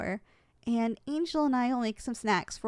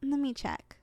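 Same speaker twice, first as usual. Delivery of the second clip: usual speaking voice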